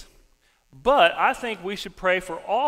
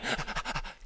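quick unvoiced puffs; mimed panting of a runner; running panting; 6 dB quieter than usual. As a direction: huff like someone dashing off. Mimics the friend running off.

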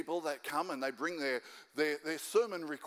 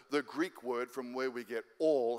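ordinary talk

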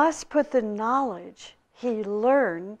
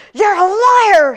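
furious high shout, slow and drawn out; outraged hermit roaring at his guest; exploding in anger; 11 semitones higher, 12 dB louder than usual. Acted. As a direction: blow up at the visitor, hurling the accusation. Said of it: "he exploded".

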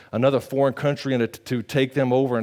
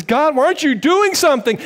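higher, pleading cry to God; Habakkuk crying out, higher; pleading prophet; 12 semitones higher, 5 dB louder than usual. Retold or imitated. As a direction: beg God for an answer. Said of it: "He says".

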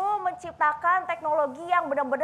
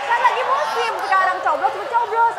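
loud rally speech to the students; campaign speech; 4 semitones higher, 5 dB louder than usual. Pretend. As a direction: call out to the crowd for votes. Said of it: "kasih pidato ke teman-teman".

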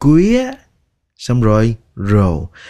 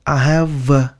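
repeated drill; 4 semitones higher, slower than usual. Shown as a demonstration. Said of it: "thì các bạn nói".

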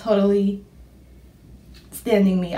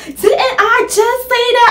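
mom; 13 semitones higher, 7 dB louder than usual. Retold or imitated. Mom voiced in the story.

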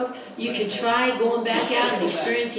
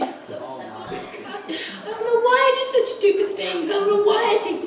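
mock-whiny repentance; 9 semitones higher than usual. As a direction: wail it half laughing. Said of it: "I'm just like".

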